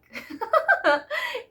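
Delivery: high, loud burst of laughter; sudden high cackle. Real, not acted. Laughs mid-rant at own point.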